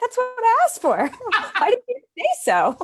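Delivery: very high, loud lines through laughter; laughing mock kid. Host joining the puppy skit, squealing with laughter. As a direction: giggle through it like the relieved kid.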